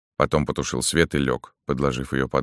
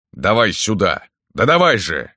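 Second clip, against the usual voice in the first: higher, insistent order; moderately heated command; impatient demand; 5 semitones higher, 4 dB louder than usual. A narrator's reading. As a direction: cut in and demand it at once. Pareika cuts in, demanding the carafe.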